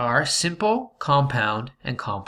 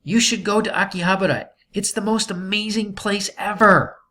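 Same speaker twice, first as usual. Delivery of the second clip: excited exclamation; 7 semitones higher than usual. Shown as a demonstration. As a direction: sell the place like you can't wait to go. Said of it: "you would say".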